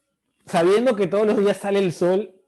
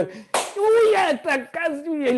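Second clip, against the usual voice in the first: high, thrilled "uy, la hice"; excited squeal; 10 semitones higher, much slower than usual. Acted. Student thrilled over a horoscope, squealing high. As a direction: gush like a kid who just got lucky.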